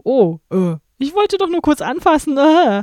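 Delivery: high whiny excuse; whiny movie character. Retold or imitated. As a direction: plead innocently in a high voice. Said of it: "mit dem Typen und dem Zwerg und dann so".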